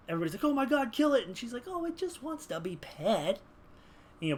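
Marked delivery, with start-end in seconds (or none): 0.4-1.3 high-pitched alarmed cry; exaggerated urgent alarm; mock panic. Everybody panics at the beast. 1.7-3.4 high, slow pet-talk; doting, drawn-out baby talk; cooing animal lover. Acted. Dakota defends the beast, cooing.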